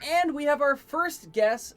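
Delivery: announcer voice. Acted. Host introducing first guest.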